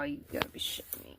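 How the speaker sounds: fast, exasperated whisper under his breath; whispered disbelief. Real, not acted. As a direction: mutter it quickly, fed up. Annoyed at nightfall.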